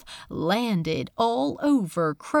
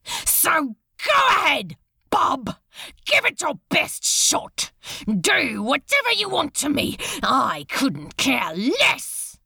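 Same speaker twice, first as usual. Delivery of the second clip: defiant tirade; 6 semitones higher than usual. Acted. Crowley taunting Beelzebub to torture him, defiant shouting. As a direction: dare him to do his worst. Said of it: "the words just kept pouring from him".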